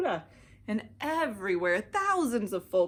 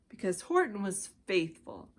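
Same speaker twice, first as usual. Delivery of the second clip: usual speaking voice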